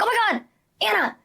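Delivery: high, exasperated self-scolding; annoyed at herself. Retold or imitated. Mother catching herself being impatient, annoyed self-scolding. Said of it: "I'm annoyed at myself".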